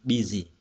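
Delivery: "Busy" with the stress on "Bu"; first-syllable stress. Shown as a demonstration. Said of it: "trọng âm rơi bấm tiết thứ nhất".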